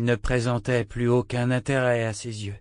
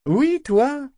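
squeaky high mock question; a sudden high-pitched teasing query; 5 dB louder than usual. A narrator's reading. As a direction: tease him in a high, bright squeak. Etta teasing Yémin.

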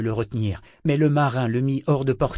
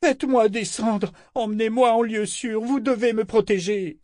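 panicked pleading; 11 semitones higher than usual. A narrator's reading. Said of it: "il la secouait frénétiquement".

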